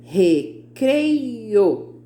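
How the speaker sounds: "Recreio" stretched as re, crei, o; three long beats on "Recreio"; syllable by syllable. Shown as a demonstration. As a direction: draw out each syllable of "Recreio". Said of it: "três sílabas".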